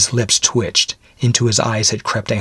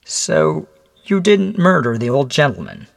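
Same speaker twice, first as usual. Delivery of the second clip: high, deliberate questioning; measured, higher-pitched interrogator; said slowly; 8 semitones higher than usual. Acted. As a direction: draw the question out, probing. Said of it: "he said slowly".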